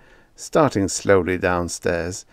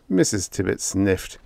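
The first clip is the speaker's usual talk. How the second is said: ordinary delivery, nothing marked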